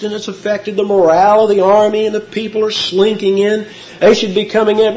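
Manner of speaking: ordinary talk, nothing marked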